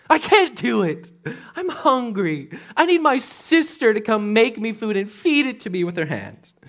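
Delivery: high, loud pouting whine; whiny spoiled son. Retold or imitated. Spoiled son whining he is too weak to cook. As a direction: whimper like a pampered prince. Amnon's fake sick whining.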